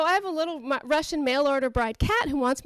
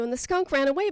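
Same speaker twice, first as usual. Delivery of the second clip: usual speaking voice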